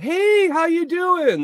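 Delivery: high, drawn-out shouted greeting; imitated yell. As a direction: call out a long, high hello across the room. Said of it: "yelled out".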